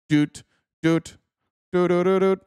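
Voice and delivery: high little "doot" noises; chipmunk doots. Pretend. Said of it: "Are you Alvin, Simon, or Theodore?".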